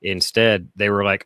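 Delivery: ordinary talk, nothing marked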